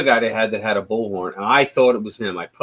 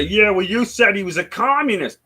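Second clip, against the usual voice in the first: higher, sneering put-on voice of a critic; mocking opponent voice; 8 semitones higher, 4 dB louder than usual. Streamer mocks critics' reply, sneering put-on voice.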